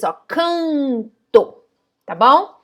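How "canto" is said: "canto" drawn out slowly, the an resonating in the nose; slow nasal canto. Shown as a demonstration. Teacher slowly showing the nasal vowel in "canto". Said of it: "sai pelo nariz".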